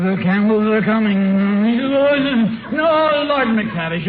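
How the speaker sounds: high panicked sleep-talk; sleep-talking nightmare. Acted. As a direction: cry out from a bad dream.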